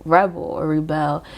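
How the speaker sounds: first "rebel" as REB-ul, second "rebel" as ri-BELL; two pronunciations contrasted. Shown as a demonstration. Reader weighing both card readings, "rebel" REB-ul, then ri-BELL.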